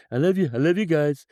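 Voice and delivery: high, hammed-up wounded protest; playful imitation of an aggrieved ruler; plangent mock plea. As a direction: plead with mock hurt, pitched well up. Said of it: "That mixture of the plangent tones".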